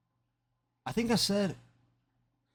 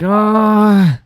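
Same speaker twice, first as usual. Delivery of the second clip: drawn-out reluctant ah; 5 semitones higher, 9 dB louder than usual. Retold or imitated. Reluctant sound before agreeing.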